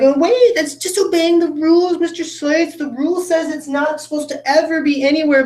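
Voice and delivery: high squeaky objection to the teacher; whiny protesting student. Retold or imitated. Teacher imitating students protesting the rule, high. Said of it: "everyone's always like".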